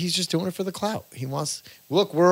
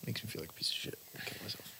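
whisper; 6 semitones lower, 22 dB quieter, faster than usual. Real, not acted. Muttering self-deprecating joke.

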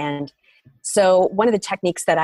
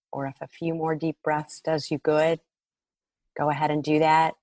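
ordinary talk, nothing marked

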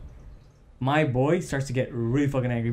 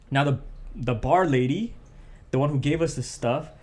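ordinary talk

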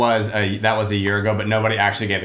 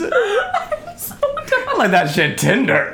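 laughing; much slower than usual. Real, not acted. Joke punchline, all laughing.

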